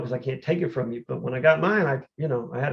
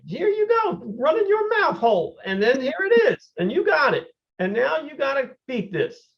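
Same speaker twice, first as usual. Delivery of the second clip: sing-song jeer at your own big mouth; taunting himself; 11 semitones higher than usual. Retold or imitated. Athlete calling out his own trash talk in a high jeer. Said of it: "I was talking like that".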